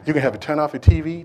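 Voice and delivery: usual speaking voice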